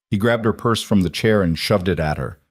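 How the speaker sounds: ordinary delivery, nothing marked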